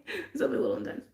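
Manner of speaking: soft unvoiced aside; low whispered afterthought. Real, not acted. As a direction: whisper it to yourself.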